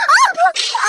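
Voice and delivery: high drawn-out scream; anguished scream. Acted. Prisoner screaming as warden tramples, anguished.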